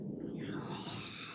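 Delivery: a long breathy sigh of defeat; mock defeated sigh; resigned whispered sigh. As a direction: sigh like it's all over. Resigned to mom coming.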